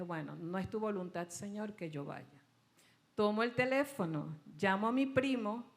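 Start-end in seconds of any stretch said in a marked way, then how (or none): 0.0-2.3 quiet resigned prayer. Retold or imitated.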